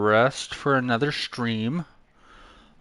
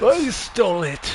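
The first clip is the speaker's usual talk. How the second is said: triumphant shout; 10 semitones higher than usual. Real, not acted. Gloating after the win.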